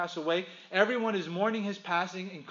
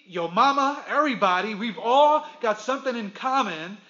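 higher, louder, driving sermon delivery; preaching at full force; 3 semitones higher, 6 dB louder than usual. Acted. Pastor drives home that everyone is spiritually dead, fervent preaching. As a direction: preach it out to the whole room.

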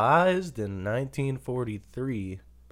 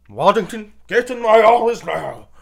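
high, loud mock boss bellow; angry boss; 9 semitones higher, 10 dB louder than usual. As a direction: bark the order like a furious boss. Boss summoning employee again.

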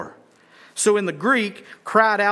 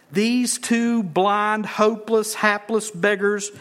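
slow emphasis; 3 semitones higher, slower than usual. Real, not acted.

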